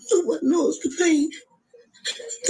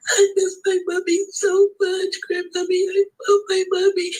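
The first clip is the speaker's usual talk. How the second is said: put-on quoting the child through tears, pitched up; crying grandson's words; 7 semitones higher, 3 dB louder than usual. Grandmother retelling her grandson's plea, crying. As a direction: repeat his plea while crying. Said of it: "And he telling me".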